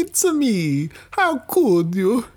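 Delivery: high, put-on Italian uncle voice; high mock Uncle Mario. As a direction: play the long-lost uncle, high and hammy.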